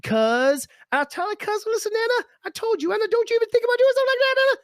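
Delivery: mock gangster warning, squeaky and fast; overdone parody of a street tough telling someone off.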